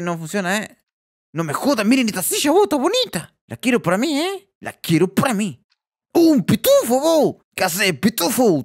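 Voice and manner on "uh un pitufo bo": high, louder cry of delight; excited discovery. Real, not acted. Gamer finds a smurf figure, high excited cry. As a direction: burst out at the find.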